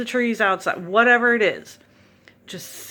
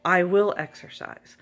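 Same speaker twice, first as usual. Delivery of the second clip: plain-level, decisive statement with weight on will; sure, declarative affirmation; firm declaration. Shown as a demonstration. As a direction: state it as settled fact, stress on will.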